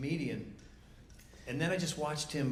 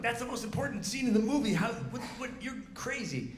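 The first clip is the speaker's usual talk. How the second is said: much higher, outraged disbelief at the editor; incredulous protest; 9 semitones higher than usual. Retold or imitated. Telling the editor his cut is crazy, high incredulous protest. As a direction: pitch it up high, aghast that the scene could go. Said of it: "And I'm like".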